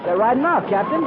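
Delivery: high and loud relieved news; excited report. Acted. Lieutenant reporting the enemy retreat, excited. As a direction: tell him the good news eagerly.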